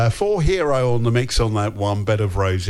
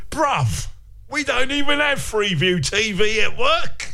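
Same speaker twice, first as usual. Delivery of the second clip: higher, playful take on Roger's message; imitating listener; 11 semitones higher than usual. Host voicing listener Roger's text, playful. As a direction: put on a listener's voice, light and jokey.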